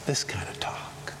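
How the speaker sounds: soft whisper, no voice at all. Shown as a demonstration. Preacher repeats the girl's words to the congregation, whispering. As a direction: hush the words right down to a whisper. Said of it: "Now, I call that".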